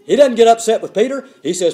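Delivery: usual speaking voice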